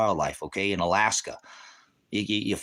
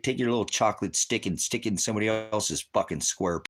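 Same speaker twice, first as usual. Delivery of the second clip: ordinary talk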